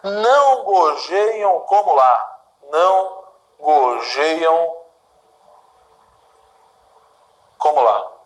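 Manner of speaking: slow, measured poem reading; poem recitation. Shown as a demonstration. Teacher reciting Gonçalves Dias to students, slow and measured. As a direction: recite each line slowly for the class.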